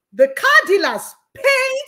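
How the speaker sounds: PAID stretched out high and loud; triumphant reveal. Real, not acted. Revealing that the car dealers covered the payment, triumphant stress on "paid". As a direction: land slowly and hard on "paid", pitched right up. Climax of her miracle story.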